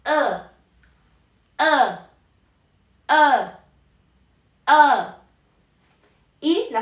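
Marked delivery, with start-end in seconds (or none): 0.0-5.2 E said from the throat, slightly nasal, louder; Mandarin e demo. Shown as a demonstration. Teacher modelling Mandarin E for the class. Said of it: "que sería como E".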